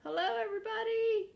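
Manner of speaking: high, loud furry puppet voice; squeaky excited monster greeting; Grover voice. Acted. Grover greets the children, high and loud. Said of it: "and he's saying".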